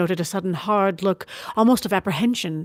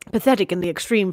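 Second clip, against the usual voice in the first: ordinary delivery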